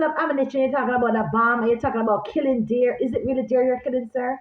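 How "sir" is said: normal talking voice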